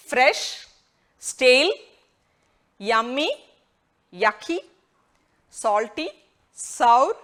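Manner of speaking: each word alone, lifted and clear; word list read. Shown as a demonstration. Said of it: "നമുക്ക് ഒന്നും കൂടെ നോക്കാം".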